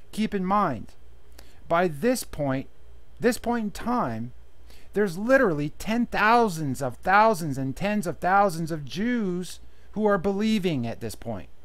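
ordinary talk, nothing marked

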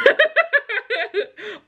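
high burst of laughter; real high-pitched laugh. Real, not acted. Laughs at own story.

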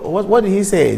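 normal talking voice

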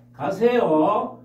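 usual speaking voice

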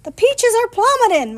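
high, loud cry of alarm; sister's shout. A narrator's reading. Sister yelling that the peaches are plummeting, high shout. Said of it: "my sister shouted".

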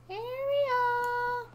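loud high call across the map; calling out for Ariel. Real, not acted. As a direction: shout her name to find her. Calling for a lost character.

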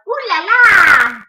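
loud playful cry; big silly shout. Acted. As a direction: belt it out for the kids.

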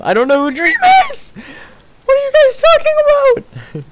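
very high, loud squeal; squealed mock outrage. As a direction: shoot up into a squeaky, exasperated shriek. Mock panic at chat's comparisons.

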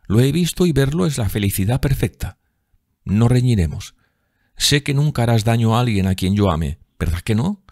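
coaxing sister. Acted. Voices Sibyl soothing Jim. (hermana mimosa y alegre, voz aguda)

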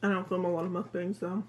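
talking while chewing; chewing between words; mouth full of donut. Real, not acted. Seen 3: bites donut at -5, cheeks full, chewing while speaking.

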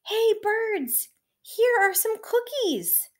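high, drawn-out call to the birds; loud shout. A narrator's reading. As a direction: holler it far across the yard. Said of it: "He shouted in a loud voice".

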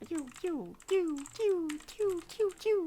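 high, soft squeaky chirps; imitated chirp. Gamer mimics the game's chirping effect, high and soft. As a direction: chirp like a tiny bird.

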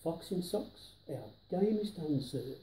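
usual speaking voice

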